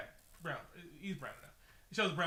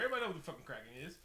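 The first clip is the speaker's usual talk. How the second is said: rapid-fire; 2 semitones higher, much faster than usual. Real, not acted. Repeated line, rapid take.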